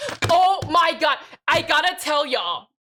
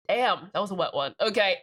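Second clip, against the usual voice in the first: ordinary talk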